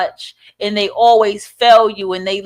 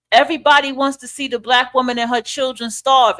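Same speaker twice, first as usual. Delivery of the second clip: ordinary talk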